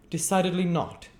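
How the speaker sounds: high, loud curt refusal; board members. Acted. Board members refuse the sweep, high and curt. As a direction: snap it shut as a chorus. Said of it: "added the other members".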